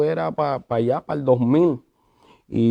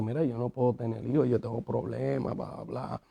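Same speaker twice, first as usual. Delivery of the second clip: low-key, fast and much softer; quiet retold confession; 3 semitones lower, 12 dB quieter than usual. Husband retells wife's private confession, quiet and hurried. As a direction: toss it off softly, fading into blah-blah.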